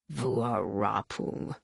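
low, slow, quiet contempt; drawn-out, soft, scornful utterance; all but spat. A narrator's reading. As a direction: spit the name out with slow disgust. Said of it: "the warrior all but spat".

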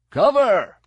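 sharp, high battlefield shout; loud, urgent one-word call; yelled warning. A narrator's reading. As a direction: yell it to warn the squad. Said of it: "yelled Carid".

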